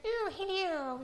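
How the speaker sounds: high, posh-sounding royal greeting; lightly mocking the Queen's telly voice; Queen impression. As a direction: do the Queen's received English. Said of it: "She's very, like, received English".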